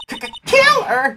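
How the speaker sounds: very high, stuttering gasp of fear; scared stammer. Acted. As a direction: stammer it out terrified. Witness stammers in fright.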